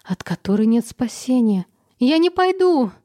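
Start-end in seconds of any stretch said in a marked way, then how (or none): 2.0-2.9 loud, high defiant cry; shouting. A narrator's reading. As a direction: yell it out in refusal. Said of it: "крикнула она". (громкий крик)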